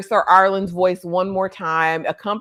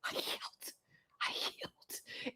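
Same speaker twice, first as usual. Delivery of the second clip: barely audible voiceless whisper; faint, halting whisper; 4 semitones higher, 23 dB quieter than usual. Retold or imitated. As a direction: whisper a feeble surrender. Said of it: "Arian wanna whisper".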